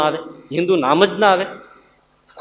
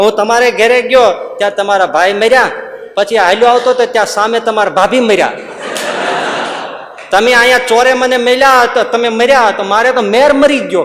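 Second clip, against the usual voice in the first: louder rural voice, મળ્યા said as મર્યા; loud villager; 5 semitones higher, 4 dB louder than usual. Retold or imitated. Preacher acts a villager telling a friend, loud and rustic. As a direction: call it out like a villager, ર for ળ. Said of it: "એને કેવું તો મળ્યા".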